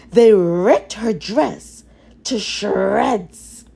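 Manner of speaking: ordinary delivery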